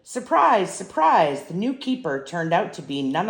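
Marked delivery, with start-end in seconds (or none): none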